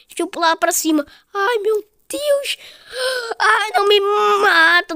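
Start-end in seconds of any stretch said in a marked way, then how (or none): 1.3-4.9 high, drawn-out fright; alarmed wail. Real, not acted. Gamer begging the game for mercy, high alarmed wail. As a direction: wail it high, as if the heart gives out. Scared by a near fall.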